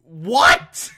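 loud, disbelieving yelp; stunned cry of disbelief; incredulous outburst. Real, not acted. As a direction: blurt it out, baffled and loud. Baffled reaction to absurd plot.

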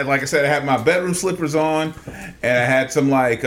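ordinary delivery

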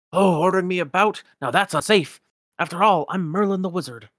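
put-on proud old wizard, amused; Merlin. Merlin voiced.

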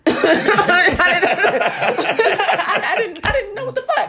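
high, breaking into laughter; giggling through the words; laughing. Real, not acted. Laughing at the weird kid.

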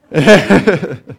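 a light burst of laughter; quick laugh; short laugh. Real, not acted. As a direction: laugh at yourself, briefly. Laughs at own request.

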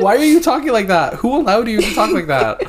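high loud laughing rant. Real, not acted. Laughing mock outrage.

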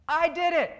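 high, loud cartoon confession; Junior. Pastor voicing cartoon Junior confessing, high and loud. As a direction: blurt it out in mock distress. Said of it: "And he confesses".

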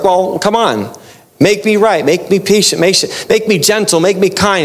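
demanding believer. Retold or imitated. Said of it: "someone's like".

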